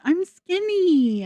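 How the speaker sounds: high, slow, mock-pleased voice; high, slow mock boast after the toilet; mock Rosie. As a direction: ham up a proud little squeal. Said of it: "Rosie after a bowel movement".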